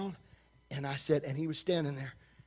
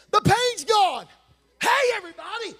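high, loud shout of joy; excited shout; 12 semitones higher, 4 dB louder than usual. Retold or imitated. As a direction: yell it out to the whole room. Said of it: "and he says".

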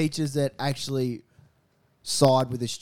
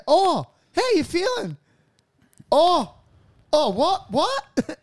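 put-on high, startled kid's voice; retold startled kid; 4 dB louder, slower than usual. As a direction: imitate a kid caught off guard, pitched way up. Retelling a kid's startled greeting.

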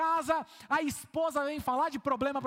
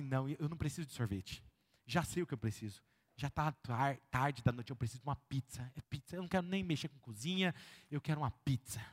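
mock craving self-talk; 10 semitones lower, 6 dB quieter than usual.

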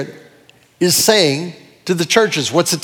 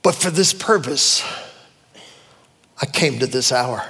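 softer and lower, settled and calm; quiet resolve; 7 semitones lower, 5 dB quieter than usual. Acted. Preacher voicing Jesus after the struggle, quiet resolve. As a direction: let the storm pass and speak it with quiet certainty. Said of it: "A moment passed and he said".

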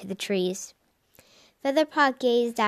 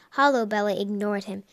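plain reading voice

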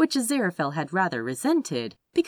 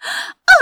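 sharp, loud gasp; 14 dB louder than usual. A narrator's reading. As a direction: suck in air in shock. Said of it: "she gave a little gasp".

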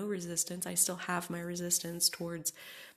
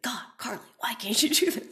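higher, much louder scolding of herself; harsh inner critic; 5 semitones higher, 10 dB louder, faster than usual. Retold or imitated. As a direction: berate yourself in frustration. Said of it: "I'd be like".

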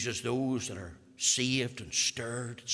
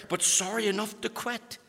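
rising, loud, higher pleading questions; impassioned preaching; 9 semitones higher, 5 dB louder than usual. Real, not acted. Preacher demanding passion from his hearers, loud and high. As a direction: cry out each question to the congregation.

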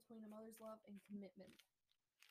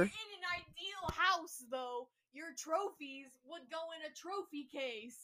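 loud teasing; 4 semitones higher, 14 dB louder than usual. Real, not acted.

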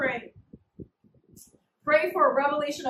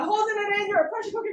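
usual speaking voice

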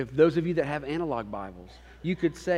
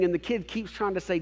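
ordinary talk

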